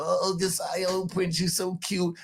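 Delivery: lightly mimicked cooing family; doting relatives. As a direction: coo over the boy like a doting aunt. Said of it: "They're gonna love him to death".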